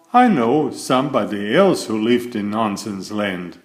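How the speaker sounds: ordinary delivery, nothing marked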